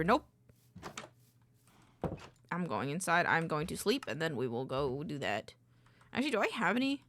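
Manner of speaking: usual speaking voice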